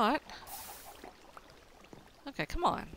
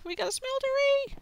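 much higher, louder triumphant cry; 5 dB louder than usual. Real, not acted. Player announcing his new smeltery to viewers, thrilled high cry. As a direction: crow it out, thrilled.

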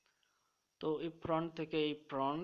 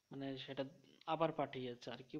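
ordinary delivery

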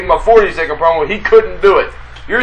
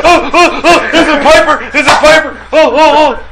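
mock eager; 8 semitones higher than usual. Imitating Hacksaw waiting for Piper.

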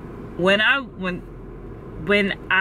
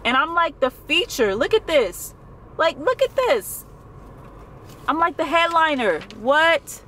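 higher, incredulous exclamations; overwhelmed excitement; 6 semitones higher than usual. Real, not acted. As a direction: show it off in amazed delight. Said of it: "I'm so excited".

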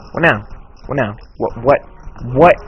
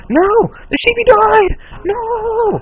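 much higher, loud, drawn-out wail; hammed-up grief, stretch every word; mock wailing; 5 dB louder, much slower than usual. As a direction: melodramatic crying over a dead sheep. Sheep killed in game.

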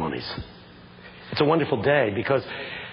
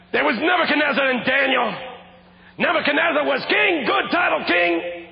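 preaching climax. Real, not acted. Preacher's refrain on titles, climactic shouted preaching. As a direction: drive the refrain home in triumph.

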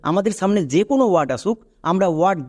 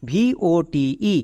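slow letter-by-letter spelling; slowly name each letter separately. Shown as a demonstration. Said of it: "এই ওয়ার্ডের উচ্চারণ করতে হবে".

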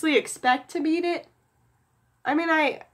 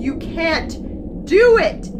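desperate yell; 3 semitones higher, 6 dB louder, much slower than usual. Real, not acted. Pleading with game character.

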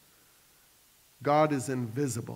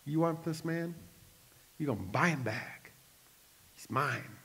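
devil's voice; 7 dB quieter than usual. Acted. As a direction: quietly gloat over the soul he owns. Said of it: "You know what the devil told God?".

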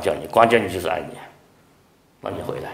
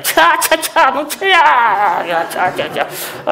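much higher, coaxing host urging food; pushy host; 6 dB louder than usual. Retold or imitated. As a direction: press guests to eat, hammed up. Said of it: "有的弟兄上海人".

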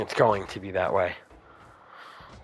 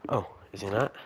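ordinary talk

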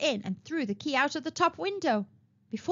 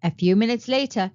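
ordinary delivery, nothing marked

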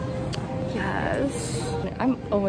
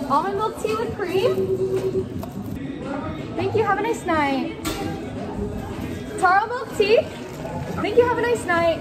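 calling out orders; 8 semitones higher than usual. Real, not acted.

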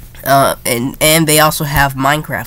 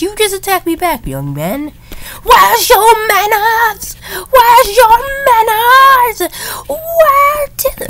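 high, slow shrieking scold; scolding old lady; 4 dB louder, slower than usual. Pretend. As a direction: shriek it like an outraged granny. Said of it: "okay, I'm gonna stop".

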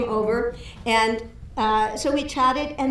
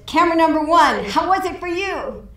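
higher, louder director's call to the cameraman; 6 semitones higher, 4 dB louder than usual. Retold or imitated. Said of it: "And so he says".

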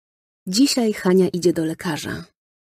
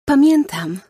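put-on high, bright child's cry; excited exclamation; 8 semitones higher, 5 dB louder than usual. A narrator's reading. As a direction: blurt it out happily remembering. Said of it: "zawołała". (radosny okrzyk dziewczynki)

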